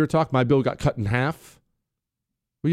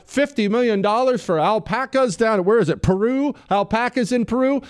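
incredulous; 7 semitones higher than usual. Retold or imitated. Incredulous questioning of the spending.

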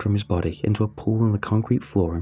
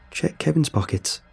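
higher-pitched call, no added volume; mild shout; 7 semitones higher than usual. A narrator's reading. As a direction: call out to deflect attention. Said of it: "I shouted".

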